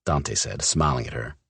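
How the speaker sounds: ordinary delivery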